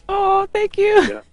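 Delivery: high, slow, touched thank you; a drawn-out, high, moved thank you. Real, not acted. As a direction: melt at the compliment. Host touched by compliment.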